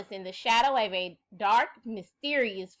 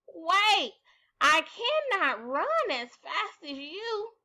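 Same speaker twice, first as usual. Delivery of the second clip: higher, slow calls after her; croaking frog; 7 semitones higher, slower than usual. Acted. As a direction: plead from behind as she runs off. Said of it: "The frog croaked after her".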